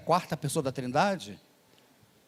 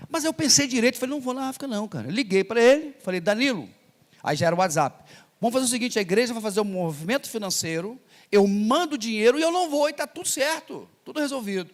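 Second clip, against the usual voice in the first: eager decision; 5 semitones higher, 3 dB louder than usual. Retold or imitated. Excited retelling of his decision.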